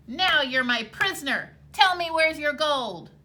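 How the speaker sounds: loud triumphant cry; cried out. A narrator's reading. Tom catching the leprechaun, loud triumphant cry. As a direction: shout it as you grab him. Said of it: "he grabbed the sly fellow and cried".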